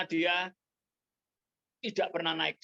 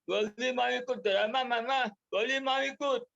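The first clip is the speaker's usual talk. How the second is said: put-on high whiny nagging child voice; child whining to his mother to join the play; 9 semitones higher than usual. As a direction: whine it like a big kid begging. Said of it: "dia tiap hari merengek-rengek".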